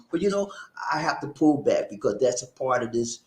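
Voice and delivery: usual speaking voice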